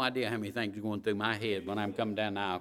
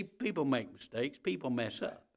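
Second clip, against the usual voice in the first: ordinary talk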